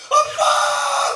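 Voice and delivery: a long, high, loud drawn-out wail; grief-stricken wail of Abba; wailing cry. Retold or imitated. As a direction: cry out for a dead father at the crematorium. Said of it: "하고 울더라".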